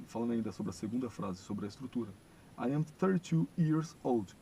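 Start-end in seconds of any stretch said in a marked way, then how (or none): 2.6-4.4 slow, louder and higher; each word drawn out; slow model sentence. Shown as a demonstration. Teacher going back to the second sentence, slow. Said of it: "vocês vão repetir o modo como eu falo".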